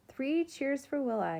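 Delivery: put-on high, loud kids' cheer; yelled cheer. A narrator's reading. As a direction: call for a cheer from the crowd. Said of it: "yelled the Udam kids".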